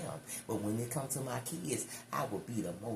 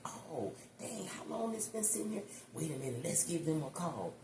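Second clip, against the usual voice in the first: acted surprised discovery; 5 semitones higher than usual. Acts out someone finding the information.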